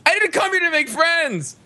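high, indignant shout; reality show yell. Retold or imitated.

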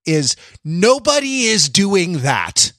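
ordinary talk, nothing marked

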